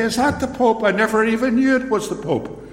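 high, feigned astonishment, dripping sarcasm; sarcastic mock surprise. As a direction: play dumb about the obvious, with heavy irony. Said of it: "employing sarcasm".

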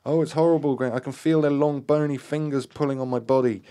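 high, loud panicked outcry; terrified cry. A narrator's reading. Gaynor feels bony fingers pulling, terrified cry. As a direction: cry out in horror, pitch held high. Gaynor dragged by spirits, crying out.

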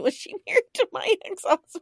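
laughing through the question. Real, not acted. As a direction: laugh it out, high. Laughing aside.

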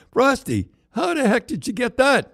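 high astonished question; stunned he got the painting; amazed. Retold or imitated. Coach sees Frosty bring the painting, astonished and high.

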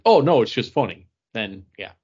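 usual speaking voice

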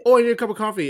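loud, high imitated yell; mock yelling. Customer walks into Starbucks, yelling into the universe. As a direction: yell it out to nobody in particular. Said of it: "they were just yelling it into the universe".

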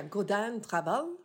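slow mock French accent; French-style vowels, drawn out. Shown as a demonstration. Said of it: "Och på franska".

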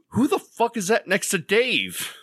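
high-pitched incredulous punchline; upset bafflement. Acted. Bystander in the crowd below the balcony, upset and baffled. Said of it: "upset, and they're like".

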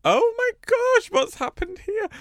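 high-pitched real amazement; astonished. Real, not acted. Astonished by what the card shows.

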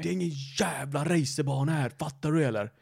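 mock angry yell at the driver; imitating an angry shout. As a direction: play the furious neighbour, lightly. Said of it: "skriker helt, att han är tokarg". (härmar en arg gubbe som skriker)

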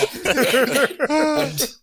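big high burst of laughter; loud laugh. Real, not acted. Laughing at his own frozen legs. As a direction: let the laugh break out.

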